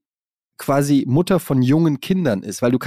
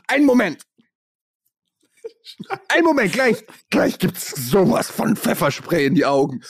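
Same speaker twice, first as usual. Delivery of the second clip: high, flustered stalling; flustered self; 5 semitones higher than usual. Retold or imitated. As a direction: stall a robber in a panic. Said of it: "diese Packung so".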